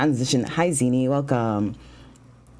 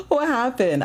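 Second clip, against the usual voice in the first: surprised gasp; 13 semitones higher than usual. Real, not acted. Streamer startled by a chat mishap, high gasp. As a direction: gasp then a high "What happened?". Guest's name got sniped.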